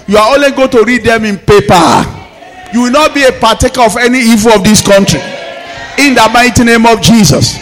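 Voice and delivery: loud, rising sermon declarations over music; full-force preaching. Acted. Pastor declaring protection over the church at full force. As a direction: proclaim it to the congregation at full force.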